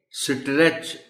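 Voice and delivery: اسٹریچ with an extra vowel before the s, "is-treech"; wrong split st. Shown as a demonstration. Teacher showing the children's mistake, with a vowel wedged into اسٹریچ. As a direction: say اسٹریچ the wrong way, breaking s and t into "sitretch". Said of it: "یہ ایسا نہیں ہے".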